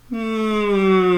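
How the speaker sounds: a sighed hum placed high. Shown as a demonstration. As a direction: hum it into the lips. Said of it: "sigh a hum to your lips".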